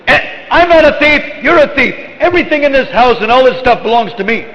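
high, indignant retort in mock anger; angry Krishna. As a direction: protest the accusation hotly, playing the offended boy. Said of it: "then Krishna gets angry and says".

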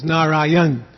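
Narayan cut to three syllables, final a dropped; three-syllable pronunciation. Shown as a demonstration. Teacher showing many people's habit, three-syllable Narayan. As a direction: say Narayan as Na-ra-yan, ending on the n. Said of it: "habit of making it three syllables".

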